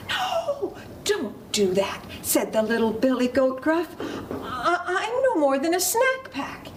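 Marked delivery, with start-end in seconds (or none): 0.1-2.0 very high, squeaky wail; high mock-scared cry; pleading little goat. 4.4-6.7 high, stammering goat voice; small storybook goat voice; pleading little goat. Acted.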